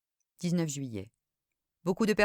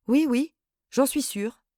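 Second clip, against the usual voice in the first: slow, higher, unsure yes; hesitant answer; 6 semitones higher, 4 dB louder, slower than usual. A narrator's reading. Hypnotized cousin forcing herself to confirm, hesitant. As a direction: force out an uncertain yes. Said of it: "Elle hésita quelques secondes".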